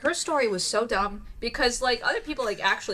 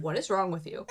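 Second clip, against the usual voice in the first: weary, softer mock reproach; tired and annoyed; 3 semitones lower, 5 dB quieter than usual. Jesus waking in the boat to panicking disciples, tired and annoyed. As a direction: act worn out by their fuss. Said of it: "like, tired and annoyed".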